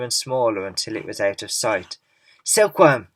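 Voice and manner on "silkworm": loud, high call of alarm; yelling. A narrator's reading. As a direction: shout his name across the deck. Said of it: "yelled James".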